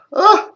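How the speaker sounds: loud, high gasp before a real sneeze; the sharp intake right before sneezing; sneeze wind-up gasp. Real, not acted. A host warning listeners on air, real sneeze gasp. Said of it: "I'm gonna sneeze now".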